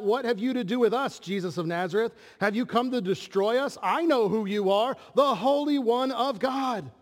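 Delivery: a hint of a shrieking demon; demon crying out. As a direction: belt it like a tormented spirit. Demon cries out in the story.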